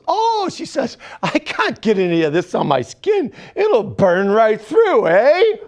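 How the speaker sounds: Canadian nurse warning, eh at the end; Canadian chemo nurse accent, rising to a loud eh. Retold or imitated. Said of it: "She was Canadian".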